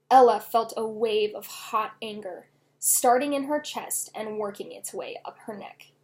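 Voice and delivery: usual speaking voice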